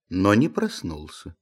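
ordinary delivery

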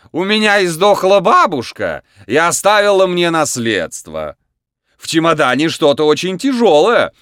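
high, loud clown patter; booming circus ringmaster. A narrator's reading. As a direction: play to the big top. Clown addresses circus audience.

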